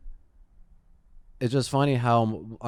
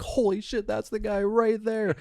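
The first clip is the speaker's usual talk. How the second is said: surprised recognition; 10 semitones higher than usual. Retold or imitated. Recognizing the screaming man.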